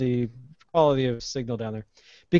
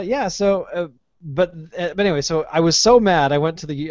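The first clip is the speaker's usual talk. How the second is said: ordinary delivery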